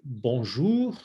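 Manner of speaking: slow bonjour with a long, rounded "ou"; drawn-out French model of bonjour. Shown as a demonstration. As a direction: make an O with your mouth on bonjour. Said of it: "for that O U".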